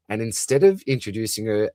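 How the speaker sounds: normal talking voice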